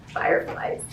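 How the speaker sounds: normal talking voice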